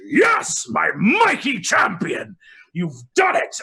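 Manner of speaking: booming, high cheer of praise; Queen of Dawn cheers her champion, loud and high; hearty queen. Acted. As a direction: shout it out like a jolly queen.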